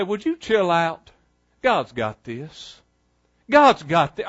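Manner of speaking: soft, low, unhurried; quietly calm a worried friend; calm laid-back friend. Retold or imitated. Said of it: "they say".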